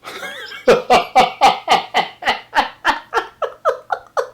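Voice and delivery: high long laugh; real delighted laugh. Real, not acted. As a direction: laugh with glee.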